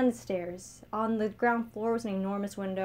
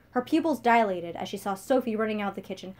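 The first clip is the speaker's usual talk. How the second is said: usual speaking voice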